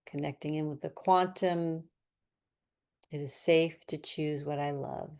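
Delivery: quiet, unhurried breath count; guided breathing. Acted. Coach counting the breath hold, quietly. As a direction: count them through the hold softly.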